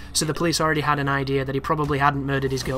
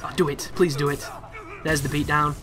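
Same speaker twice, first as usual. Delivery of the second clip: ordinary delivery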